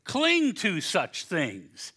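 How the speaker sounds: drawn out very slowly, Cling leaned on; slow emphasis. Shown as a demonstration. Preacher pressing the Greek word on the congregation, very slow. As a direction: stretch every word, hammer Cling. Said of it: "It's the exact same Greek word".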